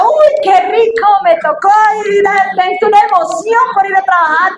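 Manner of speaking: high, loud sarcastic glee; mock excitement. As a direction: ham up fake delight. Burla del entusiasmo por trabajar. (entusiasmo fingido, agudo y fuerte)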